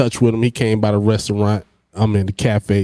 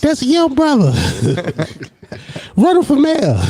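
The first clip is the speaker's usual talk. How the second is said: put-on high elderly woman's voice; old lady voice; 9 semitones higher than usual. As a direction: play the respected older lady urging. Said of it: "Like your old lady voice".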